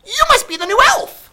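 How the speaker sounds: very high, loud squeaky elf voice; little elf man. A narrator's reading. Little man greeting Sophie, very high elf voice. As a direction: chirp it like a tiny elf. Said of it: "a little man said".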